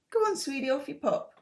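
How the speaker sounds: high cooing pet voice; talking to a cat. Real, not acted.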